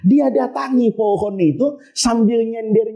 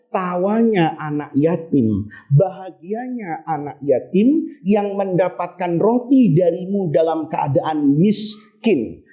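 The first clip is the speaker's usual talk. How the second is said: lower, slow and hushed like a grave angel; solemn angel voice; 7 semitones lower, slower than usual. Acted. Angel reveals the widow's tears to Ahmad, low and solemn. Angel names last deed.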